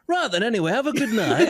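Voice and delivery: high-pitched breezy brush-off; put-on high sign-off voice; Carol impression. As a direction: mimic her airily dismissing someone. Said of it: "she just went".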